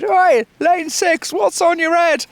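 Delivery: slow, high mock commentator shout; commentator calling out. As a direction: hail a swimmer from the commentary box. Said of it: "this wonderful commentator that they had said".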